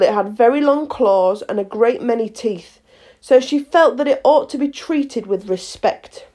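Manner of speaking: ordinary delivery, nothing marked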